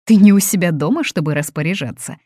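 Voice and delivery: loud, high start, then settling; indignant. A narrator's reading. Mother rebukes the guest for giving orders, indignant. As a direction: object indignantly to being bossed about. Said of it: "возмутилась Фатини".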